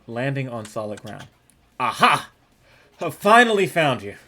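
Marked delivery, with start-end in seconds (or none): 1.8-4.2 triumphant shout. Acted. Character confronts beast.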